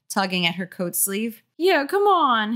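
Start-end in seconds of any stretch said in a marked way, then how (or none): 1.6-2.5 put-on high pleading boy voice; pleading kid. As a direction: beg mom to leave like a kid. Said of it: "his brother pleaded".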